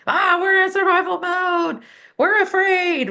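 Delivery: high, loud panicked amygdala voice; mock panic. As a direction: play the alarmed brain crying out. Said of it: "and it's like".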